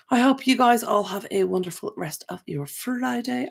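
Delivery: ordinary delivery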